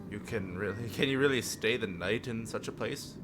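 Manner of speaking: ordinary delivery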